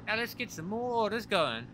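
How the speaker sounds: high-pitched Texan character voice; Texan accent. Acted. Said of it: "you gotta go full Texan".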